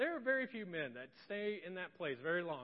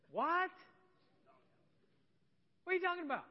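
high, loud What; outraged disbelief; 11 semitones higher, 3 dB louder, faster than usual. Pretend. Reacting to a cheating husband's excuse, shocked.

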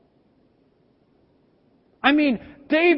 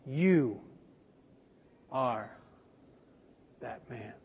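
much lower and softer, long pause after You and after are; slow grave accusation; 11 semitones lower, 9 dB quieter, slower than usual. Retold or imitated. Prophet Nathan accuses King David: low, hushed, with long pauses. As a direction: let each word drop, with long pauses. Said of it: "looks at him with these words".

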